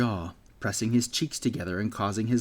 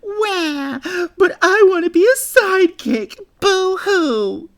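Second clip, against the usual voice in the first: shrill mock crybaby wail, much higher and louder; high-pitched whine; 12 dB louder, slower than usual. Bully Gale mimicking Jack crying, a loud high mock wail. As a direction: sob it out like a mocked baby. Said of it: "He adopted a high-pitched whine".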